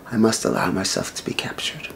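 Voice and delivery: monkey god whispering his humble surrender; reverent whisper. Acted. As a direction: give in quietly, with respect. Hanuman submits out of respect.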